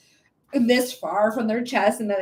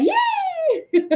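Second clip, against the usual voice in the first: squealed high yay; high-pitched gleeful yay; excited yay. Retold or imitated. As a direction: squeal it with delight. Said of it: "he said".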